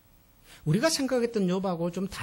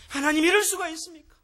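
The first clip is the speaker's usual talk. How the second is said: higher, louder anguished cry, 이럴 stressed; crying out to God; 10 semitones higher, 7 dB louder than usual. Shown as a demonstration. The preacher shows the congregation how to lament before God, higher, louder anguished cry. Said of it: "하나님 앞에서 하세요".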